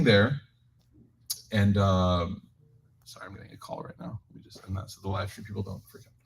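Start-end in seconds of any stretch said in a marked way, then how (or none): 3.0-6.1 much softer, half-whispered aside; hushed aside. Real, not acted. Speaker quietly excuses himself to end a phone call, hushed aside. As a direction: drop away to excuse yourself off-mic.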